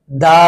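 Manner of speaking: দা with an open a before "Bangladeshi". Shown as a demonstration. Teacher modelling the article before Bangladeshi, "the" as da. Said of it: "এখানে দা উচ্চারণ হবে".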